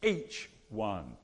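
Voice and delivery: "Each one" stressed as a firm point; emphatic. Shown as a demonstration. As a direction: stress on "Each", falling firmly. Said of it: "It's emphatic".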